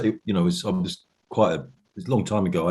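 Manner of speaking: usual speaking voice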